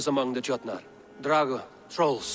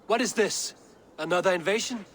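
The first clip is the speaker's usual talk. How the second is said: alarmed; 6 dB louder, faster than usual. Acted. Alarmed at sudden invasion.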